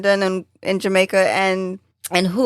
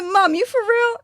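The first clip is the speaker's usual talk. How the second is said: high-pitched incredulous question to mom; retold disbelief; 12 semitones higher than usual. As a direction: question it high, as in retelling an argument. Retold disbelief at mother's claim.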